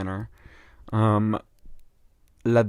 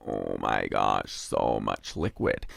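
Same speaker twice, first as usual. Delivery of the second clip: much lower and slow, mock dramatic; deep dramatic voice; much slower than usual. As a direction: drop into a deep slow groan.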